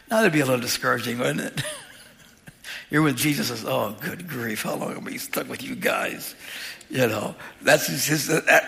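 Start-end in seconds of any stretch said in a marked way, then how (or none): 3.6-6.3 low, softly muttered exasperation; weary grumble, imitating a fed-up teacher; mock frustration. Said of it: "that's frustration".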